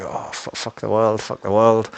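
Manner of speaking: mock-furious curse at the world; a hammed-up rant at bad luck; raging. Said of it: "I'm, like, raging".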